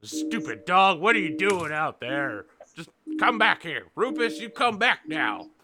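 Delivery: scolding farmer. Acted. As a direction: call the dog back crossly. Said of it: "you can hear the human".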